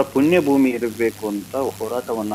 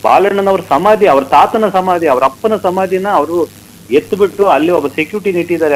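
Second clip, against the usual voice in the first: higher, louder outraged listing; raised voice, emphatic indignation; indignant; 5 semitones higher, 5 dB louder than usual. Real, not acted. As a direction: hammer out each item in real outrage. Indignant listing of family tombs removed.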